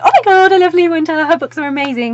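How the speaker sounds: loud, very high excited squeal; high-pitched fangirl outburst; fangirl gushing. Retold or imitated. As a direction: squeal it like an overexcited fan. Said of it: "not just me sitting here going".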